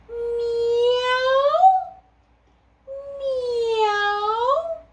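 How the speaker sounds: high, drawn-out meow; cat meow. Acted. Cat voiced in story.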